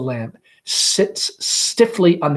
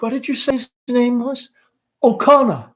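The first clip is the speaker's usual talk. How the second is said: raised, startled question; altered look; 11 semitones higher, 5 dB louder than usual. Acted. Laura hears the caller's name, startled. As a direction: suddenly alert at the name. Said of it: "Laura with an altered look".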